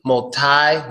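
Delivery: "multi" as mul-TIE; TIE ending. Shown as a demonstration. Teacher contrasts endings, multi with TIE. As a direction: say "multi" rhyming its end with "eye". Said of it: "three ways to say that word".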